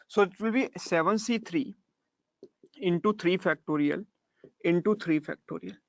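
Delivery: slow, measured dictation; dictating the answer. Shown as a demonstration. Teacher dictating the final formula, slow and measured. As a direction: read out the formula slowly so they can write.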